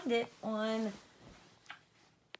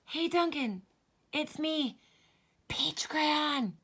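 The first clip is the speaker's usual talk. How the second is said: higher, whispered crayon greeting; hushed crayon; 7 semitones higher, slower than usual. Acted. Peach crayon whispering to Duncan from the box. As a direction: sneak a secret hello.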